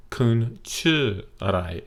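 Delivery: very slow, tones spelled out; slow model question. Shown as a demonstration. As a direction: say it slowly so learners catch the tones. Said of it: "you're gonna ask other people".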